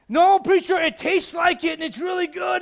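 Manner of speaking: high, loud mock objection; protesting listener. Churchgoer objecting to the preacher's strawberry point, loud high protest.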